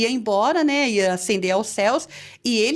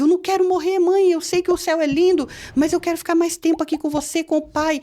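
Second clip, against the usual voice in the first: high, raised, begging voice; retold child's cry; 7 semitones higher, 3 dB louder than usual. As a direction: re-enact a boy pleading for life. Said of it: "E ele gritava". (grito suplicante de criança, imitado)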